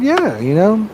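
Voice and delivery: a quick high, bright-voiced yes, lightly imitated; girl saying yes. Girl accepting the date, a quick high mock yes. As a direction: toss off her yes up high, mock girlish.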